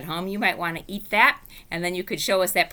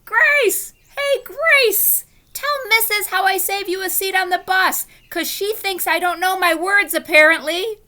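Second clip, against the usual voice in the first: very high, loud calling shout; shouted across the room; 13 semitones higher, 8 dB louder than usual. Acted. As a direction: yell across the classroom. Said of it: "I shouted across the room".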